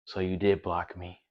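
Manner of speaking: low, very soft mimicked voice; subdued mock accusation; imitated hurt caller. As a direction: say it small and quiet, as the girl.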